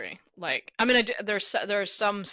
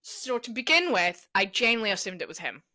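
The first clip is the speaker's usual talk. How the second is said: ordinary talk, nothing marked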